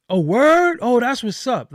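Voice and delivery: high, loud, wide-eyed mock acceptance; mock gullible reaction. Interviewer mocking a host who believes everything, loud and high. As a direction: play the easily impressed host swallowing it whole.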